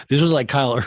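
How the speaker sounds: normal talking voice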